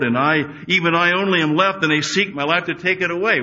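loud, high-pitched protest; impassioned complaint. Acted. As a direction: plead your lonely case at full voice. Discouraged Elijah's complaint acted out.

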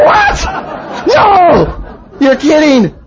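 very high squawk of mock horror; hammed-up disbelief. Man told no picking up women, hammed-up horror. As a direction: act out outraged, comic disbelief. Hammed reaction to last ban.

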